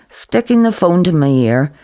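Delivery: usual speaking voice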